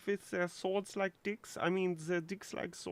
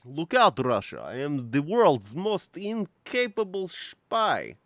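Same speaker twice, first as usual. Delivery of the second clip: put-on loud proclamation in an Eastern European accent; Nico Bellic accent; 10 dB louder than usual. Streamer boasts as an inept spy, loud Eastern European accent. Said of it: "my Nico Bellic accent".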